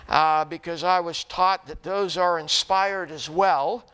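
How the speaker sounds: ordinary talk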